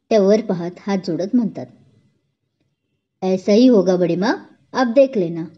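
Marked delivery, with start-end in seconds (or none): none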